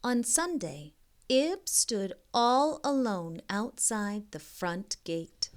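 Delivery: slow storybook reading voice; story narration. A narrator's reading. Reading a picture book aloud to young kids, gentle storybook narration. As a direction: read it like a bedtime picture book.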